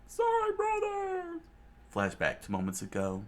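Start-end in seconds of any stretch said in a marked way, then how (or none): 0.1-1.4 much higher, slow, crying out; high yelled apology. Acted. Johnny yelling sorry to Solo from the water. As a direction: wail it toward Solo. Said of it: "And Johnny yelled out".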